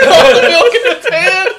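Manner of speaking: loud laughing yell; laughing shout. Real, not acted. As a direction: shout it while cracking up. Laughing at friend dribbling milk.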